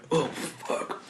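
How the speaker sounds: hushed, voiceless mock groan; imitating queasy reaction. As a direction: act out a shot going down badly, under the breath. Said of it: "his face was just like".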